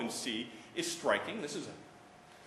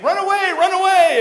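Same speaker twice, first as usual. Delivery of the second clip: panicked shout; 20 dB louder than usual. Retold or imitated. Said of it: "And then they all say".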